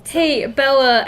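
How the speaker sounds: ordinary talk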